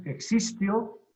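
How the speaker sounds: "existió" said e-XÍS-tio, middle syllable stressed; wrong stress on XIS. Shown as a demonstration. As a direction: stretch the middle of "existió", exístio. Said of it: "No es". ("existió" con acento erróneo, exístio)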